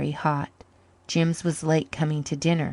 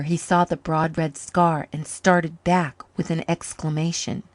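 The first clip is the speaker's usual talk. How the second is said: plain reading voice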